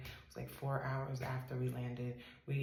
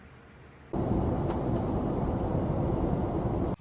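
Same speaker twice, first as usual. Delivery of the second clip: loud laugh; 12 dB louder than usual. Real, not acted. Giggles after saying so weird.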